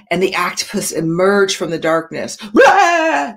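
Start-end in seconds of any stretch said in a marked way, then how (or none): 2.4-3.3 sudden loud high "Blah!"; jump-scare the kids; mock scare. Teacher pretending to scare her class as the octopus, loud. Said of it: "Did I scare you guys?".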